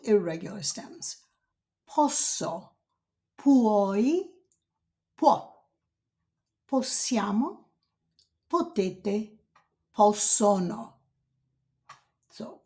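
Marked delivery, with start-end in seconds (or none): 1.9-10.9 each form of potere said slowly in Italian; Italian conjugation demo. Shown as a demonstration. Teacher modelling potere forms for students.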